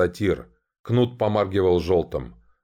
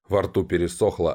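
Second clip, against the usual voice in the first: plain reading voice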